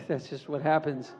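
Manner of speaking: ordinary talk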